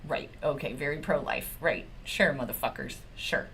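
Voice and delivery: low, soft sarcastic muttering; bitter under-breath sarcasm. Real, not acted. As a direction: drop it low and sneer it.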